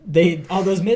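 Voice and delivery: normal talking voice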